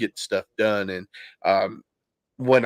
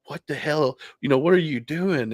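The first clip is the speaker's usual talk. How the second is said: higher-pitched mild mock yell; retold freak-out; 6 semitones higher than usual. Father retelling snapping at his daughters, mild mock yell. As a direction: replay an angry outburst without the volume. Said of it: "and I yelled at her".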